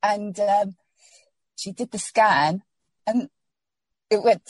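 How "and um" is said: ordinary delivery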